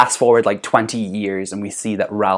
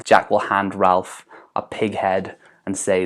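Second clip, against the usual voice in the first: usual speaking voice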